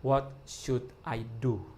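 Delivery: drawn out, every word weighed: "What should I do?"; slow, hushed "What should I do?" put to God; slow earnest question. Shown as a demonstration. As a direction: ask "What should I do?" slowly, as a quiet prayer. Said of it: "dia tanya sama Tuhan".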